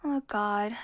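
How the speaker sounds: usual speaking voice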